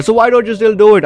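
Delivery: frustrated self-questioning. Acted. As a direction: demand it of yourself. Character arguing with herself.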